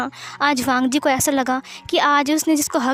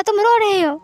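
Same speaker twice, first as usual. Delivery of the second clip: higher and louder, pressing; insistent raised voice; 7 semitones higher, 3 dB louder than usual. Acted. Wangji to Wing, insisting he is crying, raised voice. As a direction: push back hard.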